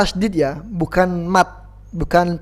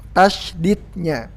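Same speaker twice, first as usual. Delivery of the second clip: ordinary talk, nothing marked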